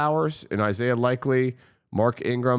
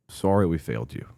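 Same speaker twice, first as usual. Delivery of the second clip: normal talking voice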